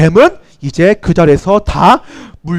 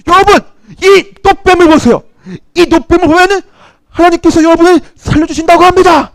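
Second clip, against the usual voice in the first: high proclaiming call to the people; raised announcing voice; calling out to crowd; 8 semitones higher than usual. Retold or imitated. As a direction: Moses calls the crowd to look. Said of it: "모세가 소리쳤어요".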